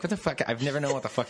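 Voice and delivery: high squeaky what the fuck; incredulous. Retold or imitated. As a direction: blurt it out in disbelief. Incredulous quote of own reaction.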